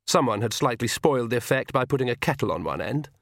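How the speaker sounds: ordinary delivery, nothing marked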